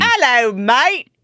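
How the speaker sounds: put-on Australian greeting; playful attempt at Australian; Australian accent. Shown as a demonstration. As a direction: try an Australian hello. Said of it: "Australian is".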